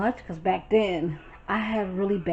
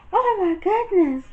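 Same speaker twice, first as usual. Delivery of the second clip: startled roommate; 10 semitones higher, 6 dB louder than usual. Retold or imitated. Roommate caught, jumps up.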